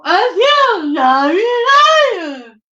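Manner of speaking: "A huge snarly lion" slow, high, loud, dramatic; imitating emphasis. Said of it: "What's the emphasis for?".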